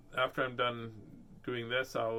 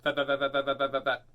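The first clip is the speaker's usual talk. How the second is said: rapid-fire repetition. Shown as a demonstration. Reading to kids, tries a tongue twister fast. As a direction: rattle off that as fast as possible. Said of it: "Was that the fastest you could go?".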